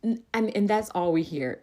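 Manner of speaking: ordinary delivery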